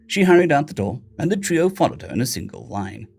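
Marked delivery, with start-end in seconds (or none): none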